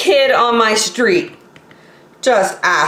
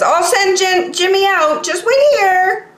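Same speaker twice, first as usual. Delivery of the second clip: friend's mom; 11 semitones higher than usual. Acted. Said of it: "she says".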